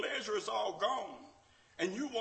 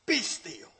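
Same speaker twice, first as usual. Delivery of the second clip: calm command; 8 semitones higher, 6 dB louder, much slower than usual. Acted. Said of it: "walked up on the ship and said".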